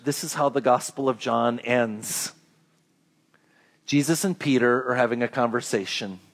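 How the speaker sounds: ordinary delivery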